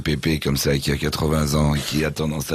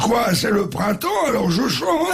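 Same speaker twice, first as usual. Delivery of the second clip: high, slow punchline; sing-song old man answer; cheeky grandfather; slower than usual. Acted.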